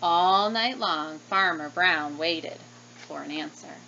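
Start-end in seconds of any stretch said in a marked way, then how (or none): none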